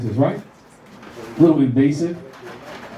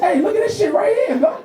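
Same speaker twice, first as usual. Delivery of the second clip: excited. Retold or imitated. Excited dad taps toddler.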